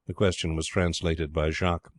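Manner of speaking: ordinary delivery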